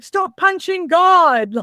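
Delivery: high, drawn-out drill instructor yell; mock-yell it slowly at a marching recruit; imitated yelling. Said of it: "they're yelling at you".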